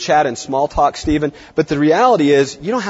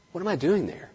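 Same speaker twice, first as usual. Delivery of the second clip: ordinary delivery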